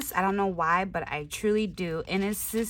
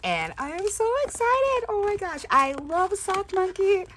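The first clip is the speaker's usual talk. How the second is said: high, loud, thrilled gushing; excited gush; 10 semitones higher, 4 dB louder than usual. Real, not acted. Crafter opening a sock monkey gift, thrilled squeal. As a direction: squeal with delight over the gift.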